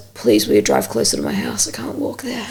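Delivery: feeble pleading. Retold or imitated. Sick ex begging for a lift closer, feeble croak. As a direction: imitate a sick man begging, fading to a whisper. Acting the sick man's plea.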